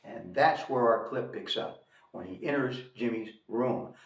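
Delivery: ordinary delivery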